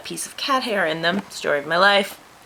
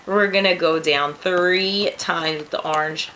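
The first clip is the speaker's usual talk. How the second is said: ordinary talk, nothing marked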